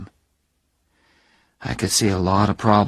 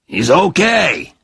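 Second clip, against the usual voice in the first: Phil's verdict; 8 semitones higher, 6 dB louder, slower than usual. Acted. Phil gives his verdict.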